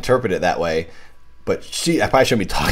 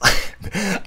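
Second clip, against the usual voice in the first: high laugh; 12 semitones higher than usual. Real, not acted. Laughs at herself.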